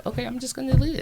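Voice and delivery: high airy carefree voice; carefree shrug. Acted. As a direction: toss it off lightly. Giving up on the argument.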